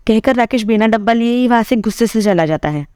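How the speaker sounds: ordinary delivery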